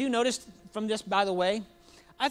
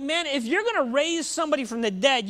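usual speaking voice

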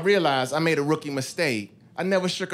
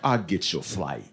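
smooth operator voice; 9 semitones lower than usual. Retold or imitated. His smooth pitch to impress her.

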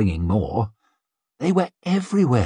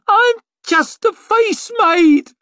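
very high, loud, drawn-out squeal; terrified squeal; 5 dB louder, slower than usual. A narrator's reading. Maid to the attacking mob, squealing in terror. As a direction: squeal it slowly in desperate protest. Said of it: "she squealed".

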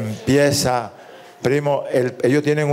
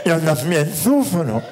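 cleft-lip voice; slower than usual. Acted. El ñato habla.

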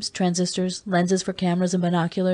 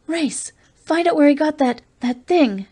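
higher, louder urgent plea; frightened plea; 8 semitones higher, 5 dB louder than usual. A narrator's reading. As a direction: beg him in sudden fear. Julie in horror.

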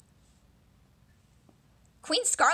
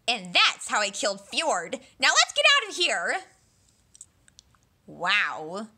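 ordinary delivery

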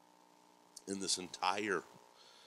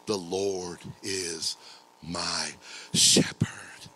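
very slow "The Lord is my shepherd", word by word; weight "my shepherd" with pauses, in a lowered pitch; emphatic personal declaration; 5 semitones lower, 4 dB louder, much slower than usual. Shown as a demonstration. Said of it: "Is that true this morning?".

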